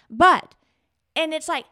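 normal talking voice

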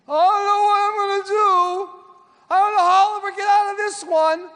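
high, loud, desperate cry; voicing despair. Preacher voicing a troubled person in high desperate tones. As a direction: play the panicked person out of options.

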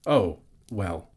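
awkward, hesitant stalling; uncomfortable. A narrator's reading. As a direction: stall as if caught out. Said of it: "said the hobbit uncomfortably".

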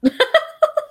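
loud, high burst of laughter. Real, not acted. Laughing at the toddler pep talk.

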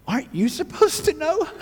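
mock bewildered. To the ministry team man, mock incredulous. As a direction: act baffled at the expert. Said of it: "And I was like".

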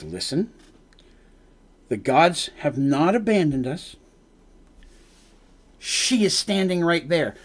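soft, slow, patient reassurance; calm and fatherly. Acted. Fenton calming frustrated Menandra, slow and soft. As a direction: talk her down gently, no rush. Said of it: "Gets more calm".

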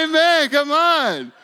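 high, drawn-out, very loud amen; jubilant shout. Retold or imitated. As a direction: shout it out like a rousing preacher. Retells his jubilant answer to her.

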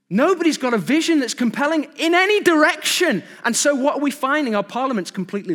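loud, high-pitched exasperated preaching; impassioned preaching. Real, not acted. Preaching at full force.

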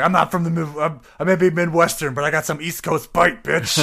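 loud tough East Coast snarl; East Coast bite. Retold or imitated. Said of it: "I'm like".

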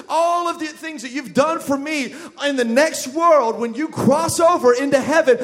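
loud, driving sermon climax; loud, rising preacher's cry of promise; full-force preaching. Acted. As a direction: preach it to the back row.

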